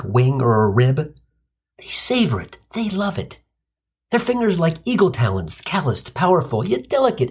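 ordinary talk, nothing marked

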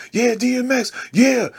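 high hype shout; excited cheering. Retold or imitated. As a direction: cheer like a fan at the front. Cheering at the concert.

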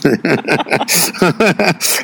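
breathy run of chuckles; laughing. Real, not acted. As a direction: chuckle at your own joke. Laughs at own reworded saying.

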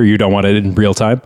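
normal talking voice

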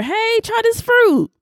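high, slow, sweet mock Eve; a lightly voiced tempting Eve; mock Eve offer. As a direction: dangle the fruit innocently. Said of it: "she just came over".

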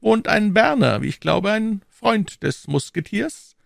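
high, even, unemotional cardinal; dispassionate cardinal. A narrator's reading. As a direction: lay it out without feeling.

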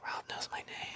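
starstruck whisper; awed whisper. Retold or imitated. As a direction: whisper it in disbelief.

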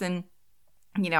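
ordinary talk, nothing marked

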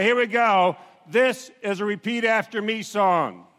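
loud upbeat camp greeting; camp counselor cheer. Real, not acted. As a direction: pump up the campers. Camp leader hypes campers.

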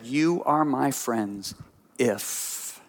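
slow "You are my friends", then a hushed, drawn-out "if"; stress on if. Shown as a demonstration. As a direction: lean on the final "if" as a condition. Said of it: "go look it up in your Bible, "if".

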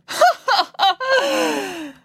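high, loud forced laugh; overloud laughter. A narrator's reading. Professor Maynard laughing at Mister Lang, overloud. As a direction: burst out laughing too loudly. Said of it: "burst into overloud laughter".

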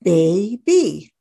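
put-on "baby" stretched as two beats, bay and bee; split into syllables. Shown as a demonstration. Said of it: "Every syllable needs at least one vowel".

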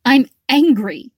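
angry. Acted. Tearful woman declares anger.

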